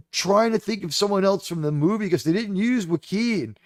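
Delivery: ordinary talk, nothing marked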